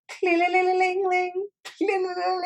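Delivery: high-pitched sung-out ling-ling; imitated shop bell jingle. Retelling a comic's shop act-out, high imitated jingle. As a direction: trill a high, ringing jingle. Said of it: "him going".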